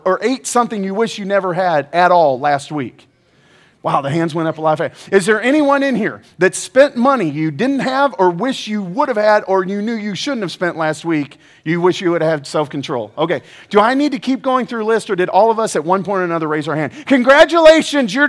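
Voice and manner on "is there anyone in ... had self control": ordinary delivery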